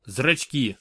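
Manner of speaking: ordinary delivery, nothing marked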